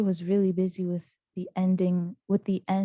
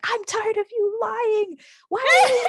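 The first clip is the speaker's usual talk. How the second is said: mock yell; 7 dB louder than usual. Sister accusing her of lying, hammed-up yelling. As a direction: imitate a fed-up sister yelling. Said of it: "to yell at me actually".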